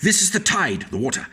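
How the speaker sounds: usual speaking voice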